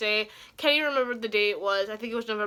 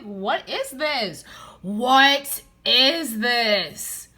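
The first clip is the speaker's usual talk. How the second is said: slow exasperated disbelief; 2 semitones higher, 7 dB louder, much slower than usual. Retold or imitated. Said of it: "I'm like".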